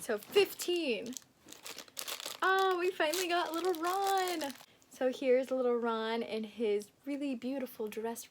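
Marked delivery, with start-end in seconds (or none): 2.4-4.5 high, excited coo; happy high-voiced exclamation; delighted reveal. Real, not acted. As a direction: pitch it up with glee. Reveals the calendar figure.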